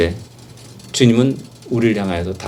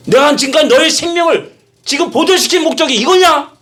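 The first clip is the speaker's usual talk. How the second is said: stern rebuke; 4 dB louder than usual. Acted. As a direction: confront Levi firmly with his calling. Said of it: "라고 단호하게 이야기하는 겁니다". (아주 높고 크게 단호히 꾸짖는 물음)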